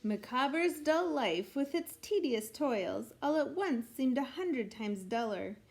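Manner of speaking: ordinary delivery, nothing marked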